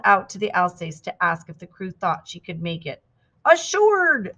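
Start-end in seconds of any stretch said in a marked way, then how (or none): 3.4-4.4 high, loud cheering holler; yelled back. A narrator's reading. Crew answering Trudy from the Alsace, yelled back. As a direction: call it out with the pitch way up. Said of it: "they yelled back".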